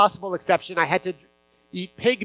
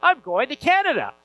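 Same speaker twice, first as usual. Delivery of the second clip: higher, louder eager announcement; excited news; 7 semitones higher, 4 dB louder than usual. Retold or imitated. Boy tells his whole village he's leaving, excitedly. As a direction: burst out with the big news.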